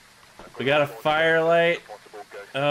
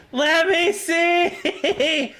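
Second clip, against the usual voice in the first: stretched-out whine of frustration; drawn-out wail; 13 semitones higher, much slower than usual. Real, not acted. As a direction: drag every word out in despair. Same plea, dragged out.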